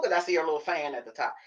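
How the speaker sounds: usual speaking voice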